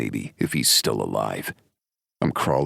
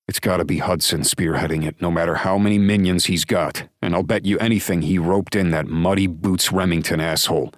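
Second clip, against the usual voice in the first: heated and fast, louder on the minions line; a hint of anger, fast and louder; angry certainty; 5 semitones higher, 4 dB louder, faster than usual. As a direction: spit out the suspect's name with grudge. Narrator's angry claim.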